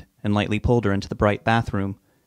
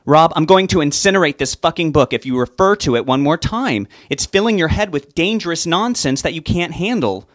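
louder, higher, furious threat; angry outburst; 7 semitones higher, 5 dB louder than usual. Acted. Wife threatening to burn her husband's book, an angry raised voice. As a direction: snap at him, fed up and loud. Sue loses patience with Rob.

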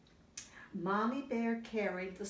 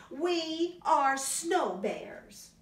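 high and slow, drawing each word out; slow high baby bear voice; 8 semitones higher, 5 dB louder, much slower than usual. Acted. Parent reading aloud, cubs announcing slowly and high. Baby bears declare snow bears.

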